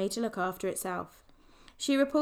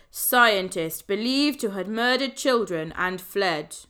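headline read; 7 dB louder, slower than usual. A narrator's reading. Reading a newspaper headline.

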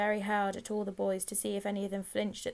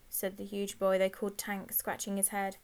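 plain reading voice

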